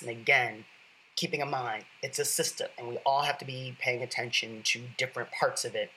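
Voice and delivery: normal talking voice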